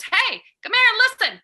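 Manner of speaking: high, loud friendly call. Pretend. Host calling strangers over in a loud, high call. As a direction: call out to draw people in.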